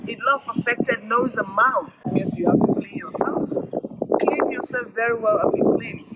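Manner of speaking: ordinary delivery, nothing marked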